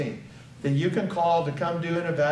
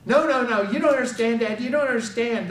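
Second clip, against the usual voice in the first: higher, louder retold pleading; mildly frantic, pitched up; panicked protest; 6 semitones higher, 3 dB louder than usual. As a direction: insist urgently, as if imitating panic.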